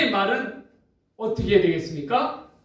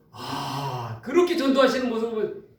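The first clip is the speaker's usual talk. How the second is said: normal talking voice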